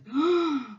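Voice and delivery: scared gasp. Acted. Voicing scared cat, gasping.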